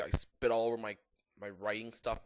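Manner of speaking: plain reading voice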